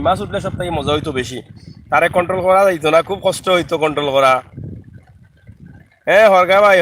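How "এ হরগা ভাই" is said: loud call across the riverbank. Real, not acted. Calling out about the fishing net. (জোরে ডাক দেওয়া)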